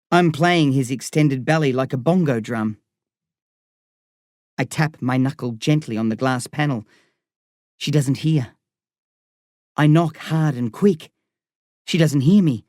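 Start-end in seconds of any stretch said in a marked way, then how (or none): none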